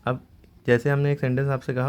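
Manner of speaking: ordinary talk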